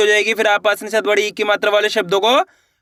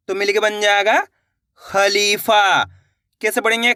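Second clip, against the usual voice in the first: normal talking voice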